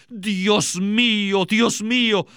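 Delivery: high, drawn-out appeal without shouting; the Savior's mild outcry. Retold or imitated. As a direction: lightly act out a cry to God. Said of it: "gritó a gran voz".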